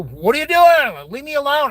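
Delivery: loud, high-pitched snap at nosy people; retold exasperation, pitch shooting up; fed-up plea to be left alone; exasperated outburst. Acted outburst at prying people.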